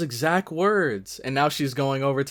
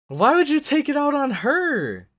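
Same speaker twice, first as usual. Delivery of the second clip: indignant; 10 semitones higher, 3 dB louder than usual. Real, not acted. Scolding character on screen.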